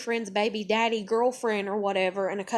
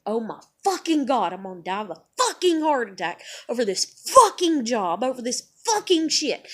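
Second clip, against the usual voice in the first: forceful, punched curse words; exasperated rant. Retold or imitated. Fretting about a heart attack, forceful and exasperated. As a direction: hammer each expletive harder. Same panic line, then forceful.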